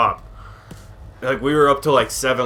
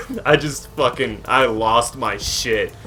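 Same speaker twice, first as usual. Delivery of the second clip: ordinary talk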